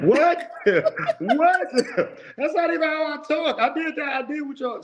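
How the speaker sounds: very high falsetto protest, laughing; mock disbelief. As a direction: squeal it in comic, hammed-up indignation. Acted disbelief at being called aggressive.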